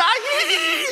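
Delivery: very high, giggly ghost cackle; a child's squeaky spooky laugh; ghost giggle. Retold or imitated. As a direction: cackle like a playful little ghost. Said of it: "이러고 쫓아다니는 거예요".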